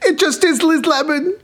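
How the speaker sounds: very high laughing voice; bad Tracy Morgan. Admits his bad Tracy Morgan impression, very high and laughing. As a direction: squeak the line out like Tracy Morgan. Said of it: "I really do a bad Tracy Morgan".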